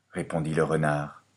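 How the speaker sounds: ordinary delivery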